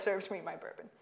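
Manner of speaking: ordinary talk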